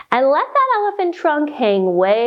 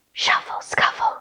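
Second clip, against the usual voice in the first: soft whispered rustle words; 5 dB quieter than usual. Acted. Night noises for the kids, whispered. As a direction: breathe the words like something creeping. Listing noisy night sounds.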